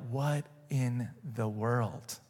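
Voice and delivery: very soft, slow and low; stunned, word by word, barely voiced; hushed astonishment. You react to the stilled storm, hushed astonishment.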